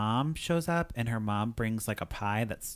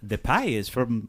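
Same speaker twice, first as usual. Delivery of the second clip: slow Irish accent, breaking into laughter; attempted Irish accent. Shown as a demonstration. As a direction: try an Irish mom's line, about to crack up. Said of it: "here's my attempt to do the mom's accent".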